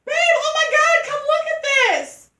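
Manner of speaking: high, very loud reenacted yell; retold scream. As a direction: scream for your boyfriend, reliving the freak-out. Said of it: "I'm screaming, I'm like".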